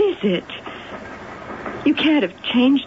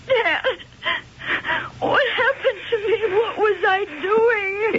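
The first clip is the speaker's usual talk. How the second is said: tearful confusion; 12 semitones higher than usual. Acted. Daughter coming to after attack.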